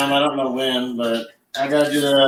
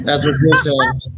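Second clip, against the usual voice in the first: mock joke voice; slower than usual. Joke in a put-on voice.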